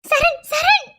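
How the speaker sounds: urgent call to someone already gone. Acted. Calling after husband as he hangs up.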